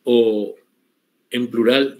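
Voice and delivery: ordinary talk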